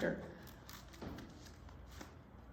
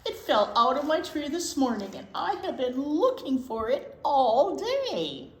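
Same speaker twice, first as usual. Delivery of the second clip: squirrel voice; 5 semitones higher, 7 dB louder than usual. Acted. Squirrel explains losing its nut.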